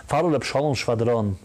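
ordinary talk, nothing marked

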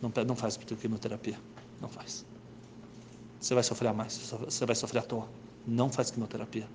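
a touch of tearful begging, quiet; mild tearful plea; son crying. Acted. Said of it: "O filho começa a chorar".

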